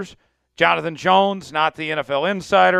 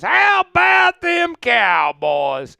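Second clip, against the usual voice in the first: mock Cowboys cheer; 9 semitones higher, 4 dB louder, slower than usual. Mocking the Cowboys' catchphrase.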